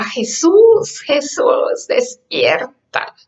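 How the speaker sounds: high mock cry of "Jesús", fading to a slow "despierta"; retold cry for help. Peter calling to Jesus in the storm, high retold cry. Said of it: "Y Pedro le gritaba".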